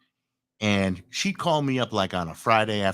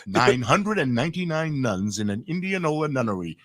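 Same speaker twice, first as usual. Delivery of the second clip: tongue twister drill; crisp announcer warm-up; vocal warm-up; 2 semitones higher than usual. Shown as a demonstration. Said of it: "I did that in the bathroom".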